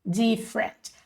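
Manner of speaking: "different" repeated carefully as "DIF-rent"; correct English pronunciation. Shown as a demonstration. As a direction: model "different" in two syllables, ending on a clear T. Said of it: "você vai falar different".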